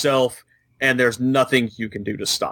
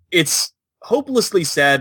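ordinary delivery

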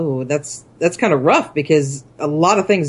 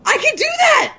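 much higher, bursting with eagerness; eager volunteering. Real, not acted. Co-host volunteers for the challenge, thrilled and high. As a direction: jump at the chance.

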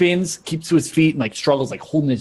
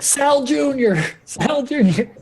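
very high, strained mock yell; mock shouting; 14 semitones higher, slower than usual. Game master as Heather calling targets, high mock shouting. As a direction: cry the name out in a high voice. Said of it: "You're, like, shouting".

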